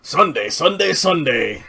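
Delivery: loud booming announcer hype; monster truck ad. Pretend. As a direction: yell it like a monster truck commercial. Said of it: "like a monster truck commercial".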